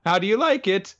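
high, girlish put-on voice; eager girl. Girl showing her kidnapper her outfit, mock eager. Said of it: "And she's like".

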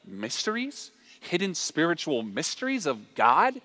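awed, questioning echo with rising endings; impressed Corinthians. Acted. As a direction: marvel at each phrase as a question. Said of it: "they would be eating that language up".

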